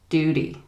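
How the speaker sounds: American "duty" with a d-like middle; "duty" with the t softened to d; American flapped t. Shown as a demonstration. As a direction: say "duty" as DOO-dee. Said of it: "changes to a kind of D sound".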